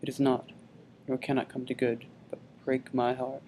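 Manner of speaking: soft, inward brooding; subdued grief. Acted. Hamlet alone, resolving to keep silent, subdued grief. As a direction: keep it hushed, as if to yourself.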